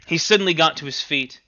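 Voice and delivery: usual speaking voice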